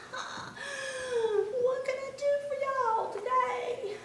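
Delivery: a fox who knew they'd come; sly fox. A narrator's reading.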